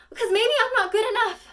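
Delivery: loud high sob through the words; crying. Acted. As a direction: break down crying on it. Monologue climax, crying.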